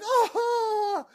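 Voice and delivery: long, very high mock "oh"; imitated group reaction. Retelling how they all reacted, high drawn-out oh. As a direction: squeal it out like a whole amazed group. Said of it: "They all just like".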